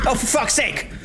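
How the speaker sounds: high, exasperated cry; frustrated outburst. Real, not acted. Gamer after failing a sneak attack, frustrated outburst. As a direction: blurt it out in exasperation. Player fails the attempt.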